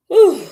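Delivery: high, loud puff of breath; breathless whew. Real, not acted. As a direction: exhale hard, winded. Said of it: "I am just so out of breath".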